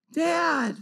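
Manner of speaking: put-on high, drawn-out child's whine; whiny high call for a parent; little boy's cry voice. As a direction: imitate a tearful kid calling from upstairs. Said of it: "I hear the cry voice from upstairs".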